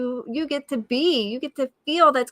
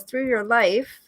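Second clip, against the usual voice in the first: usual speaking voice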